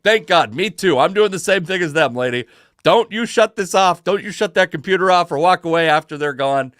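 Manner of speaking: half-playful desperate insistence at the counter; mock-urgent pleading to the check-in lady; urgent plea. Pleading with airline desk lady.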